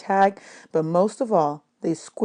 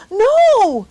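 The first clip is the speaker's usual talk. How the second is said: high, loud frightened cry; terrified girl crying out; desperate no; 10 dB louder than usual. A narrator's reading. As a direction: cry it out in panic. Margot resisting.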